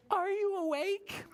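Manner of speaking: very high, drawn-out question; rabbits calling. Shown as a demonstration. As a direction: model the rabbit call for children to copy. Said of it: "Can you say that?".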